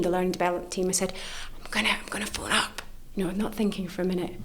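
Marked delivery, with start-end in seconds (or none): 1.6-2.8 fast hushed whisper. Retold or imitated. Telling the work team she'll phone, whispering quickly. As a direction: rush it out under your breath.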